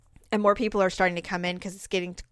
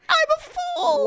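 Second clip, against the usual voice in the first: much higher, slow, theatrical self-pity; hammed-up heartbroken fool; 3 dB louder, slower than usual. Teller voices the crying costumed man at the fair, mock high wail. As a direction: wail it like a melodramatic jilted lover.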